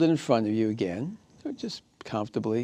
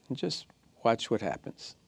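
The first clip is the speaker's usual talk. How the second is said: ordinary talk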